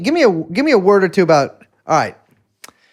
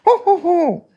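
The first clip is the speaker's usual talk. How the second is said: high put-on ho ho ho; mock Santa laugh; 12 semitones higher than usual. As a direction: belt out a silly Santa laugh. Sounds of Sillydelphia listed.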